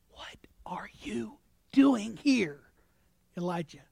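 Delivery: slow, breathy whisper of God; gentle whisper. Acted. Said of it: "came a gentle whisper".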